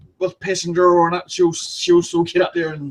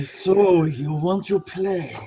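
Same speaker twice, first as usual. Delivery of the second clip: creepy sexy voice; 4 dB quieter, much slower than usual. Shown as a demonstration. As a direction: draw each word out like a tease. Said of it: "creepy, sexy voice".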